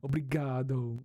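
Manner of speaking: loud "Obrigado" with a very clear closing O; punched-out "Obrigado", the last O fully rounded; exaggerated final O. Shown as a demonstration. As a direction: make the O at the end of "Obrigado" unmistakable. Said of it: "I have to make the O apparent".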